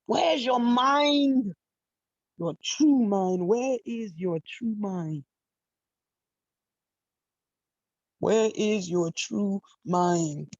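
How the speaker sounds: drawn out, each word weighed; slow it down and let it land; slow probing question. Real, not acted. Preacher asking her listeners, slow and probing. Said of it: "probing repeated question".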